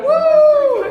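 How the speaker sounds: high yelp of mock pain, laughing; pained woo. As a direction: squeal as the pinch stings. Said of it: "I'm like".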